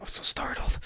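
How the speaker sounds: mock-scared whisper; hushed, hammed-up horror confession; startled parody. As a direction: whisper to the camera in fake fright. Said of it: "He's just like".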